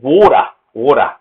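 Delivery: Australian "water" as waw-duh; Australian pronunciation. Shown as a demonstration. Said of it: "is Australian English".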